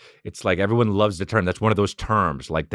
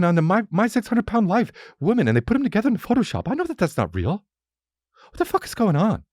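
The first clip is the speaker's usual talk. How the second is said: bewildered rant; 12 semitones higher than usual. Retold or imitated. Ranting about the Instagram outage.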